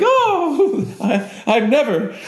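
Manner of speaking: high, laughing impression of a shocked lady; scandalized woman. As if some guy walked in on her, hammed-up scandalized woman. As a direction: gasp it high and slow, mock-affronted. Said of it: "like if I was a woman and some guy came in".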